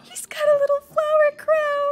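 high, loud delighted squeal; shrieking with delight at a cute photo; excited squeal. Real, not acted. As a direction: squeal it out with glee. Gushing over cute Pokemon photo.

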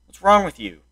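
usual speaking voice

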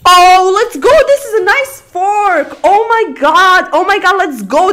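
high, loud and thrilled; real excitement, pitch up and loud; excited. Real, not acted. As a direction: cheer over a winning fork. Excited at a chess fork.

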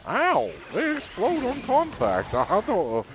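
high, loud startled yelps; pained yelps. Real, not acted. Player hit by exploding bugs, yelping high and loud. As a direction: yelp as the blasts land.

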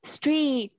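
"Street" with a long, stretched ee; long vowel. Shown as a demonstration. Teacher explaining the double e, long vowel. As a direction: hold the ee in "Street" before the final t. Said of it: "chúng ta thành y dài đó".

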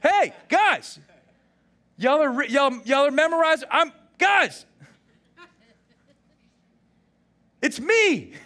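waving down the crowd, hey guys, it's me; frantic Jesus calling out. Retold or imitated. Pastor acting Jesus calling to the Pharisees, loud and high. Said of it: "And Jesus is like".

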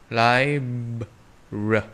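"Library" very slow, lips closing on the b; slow library demo. Shown as a demonstration. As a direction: slowly spell out "Library", lips together for b. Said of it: "sau đó ngậm môi âm bờ".

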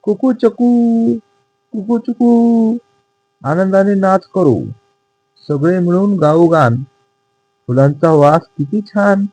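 high, drawn-out crow easing into a joyful chant; happy storybook rooster, pitched high. A narrator's reading. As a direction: crow it out slowly, then sing-song happily. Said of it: "तोंड करून कोंबडा आरवला".

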